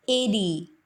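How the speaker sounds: "एरी", the t as a quick flap; flapped t "eighty". Shown as a demonstration. Teacher showing the native eighty. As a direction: say "eighty" as "eidy". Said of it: "एटी को बोलेंगे एरी".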